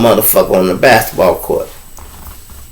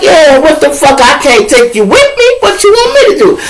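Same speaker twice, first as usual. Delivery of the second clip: high, louder mock exasperation; exasperated retort; 3 dB louder than usual. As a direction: shrug it off loudly. Narrator answers friends, exasperated.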